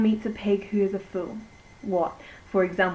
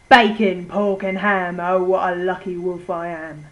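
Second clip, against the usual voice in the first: much louder, gloating wolf cry; gleeful wolf shout; 7 dB louder than usual. Acted. The wolf crowing over his meal in a loud shout. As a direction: bellow it out like a greedy wolf. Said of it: "He shouted".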